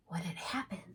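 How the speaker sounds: wondering whisper. A narrator's reading. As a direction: ask it hushed and puzzled. Mystery question, whispered.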